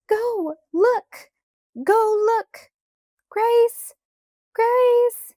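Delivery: very high, loud repeated screams; screaming. A narrator's reading. Carrie screams at Laura to find Grace, hysterical. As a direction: scream frantic orders, then the lost child's name. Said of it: "Carrie screamed".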